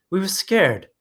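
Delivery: high, pleading insistence; insistent. A narrator's reading. Piggy insisting to Ralph, high and pleading. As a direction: push the fear out high.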